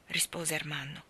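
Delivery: ordinary delivery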